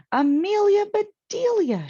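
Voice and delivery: very high, loud cry of her name; shocked exclamation. A narrator's reading. Missus Rogers sees the popcorn, shocked and loud. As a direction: gasp out her name in dismay.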